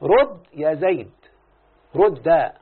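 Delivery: a lightly voiced slow order, رد يا زيد; mock command. Shown as a demonstration. Teacher acting out a command to Zayd. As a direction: give the order slowly, half-acted. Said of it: "الأمر كالمضارع المجزوم".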